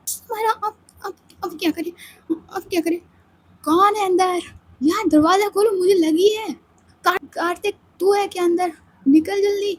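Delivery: high, pressing plea through a door; desperate to get in the bathroom; urgent knocking friend. Acted. Ananya begging Samaira through the locked door, pressing.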